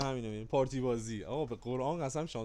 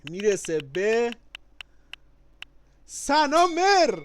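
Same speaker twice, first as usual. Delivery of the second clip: very slow, loud, high drawn-out reveal; milk the winner's name like a game-show host; hammed-up prize reveal, stretching each syllable; draw announcer; 9 semitones higher, 13 dB louder, much slower than usual.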